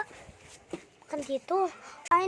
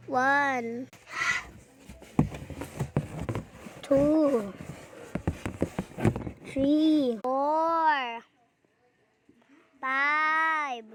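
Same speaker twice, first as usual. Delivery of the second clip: calling out the count; much slower than usual. Real, not acted. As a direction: call each number out slowly. Counting loudly in hide-and-seek.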